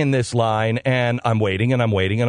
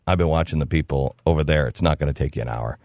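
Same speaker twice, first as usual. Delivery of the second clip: lightly voiced stranger; 13 semitones lower, faster than usual. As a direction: confide it in a low voice. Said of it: "the person behind me said".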